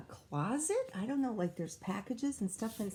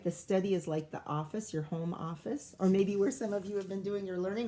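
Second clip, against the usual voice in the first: usual speaking voice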